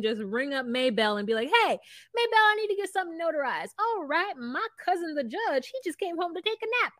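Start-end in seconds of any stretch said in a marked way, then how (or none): 1.5-3.7 high, quick Southern-town caller; small-town caller. Retold or imitated. Ringing up Maybell in a small Southern town, folksy caller. 3.8-6.9 high, folksy, hurried reply; small-town Southern local. Retold or imitated. Maybell offering her cousin the judge, folksy Southern voice.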